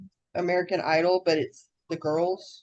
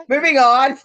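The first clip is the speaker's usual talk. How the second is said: laughing; 8 semitones higher, 9 dB louder than usual. Real, not acted. Laughs at own lost train.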